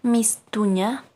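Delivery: loud slow teacher model of mistuña; slow model word. Shown as a demonstration. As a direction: say mistuña slowly for the class to echo. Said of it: "repitan después de mí".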